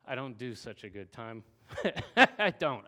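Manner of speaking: sheepish laugh through the words. Real, not acted. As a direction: laugh at yourself, a little embarrassed. Joking about himself, wife present.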